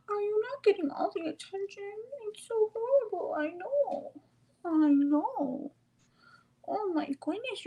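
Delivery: very high cooing, pitying the pet. Real, not acted. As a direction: coo up high, mock sympathy for a pup. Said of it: "talk like that to me".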